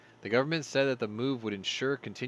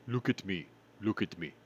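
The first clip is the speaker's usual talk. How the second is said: mock pirate takeover line; hammed-up movie quote; Captain Phillips quote; 4 semitones lower than usual. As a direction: imitate the look at me line, hammed-up. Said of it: "They had that moment".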